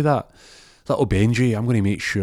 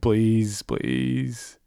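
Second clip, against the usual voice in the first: pleading; 4 semitones higher than usual. Acted. Ron begs in acted story.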